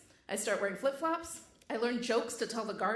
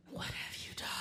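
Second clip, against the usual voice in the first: whispered; 9 dB quieter than usual. Retold or imitated. Room stares at her.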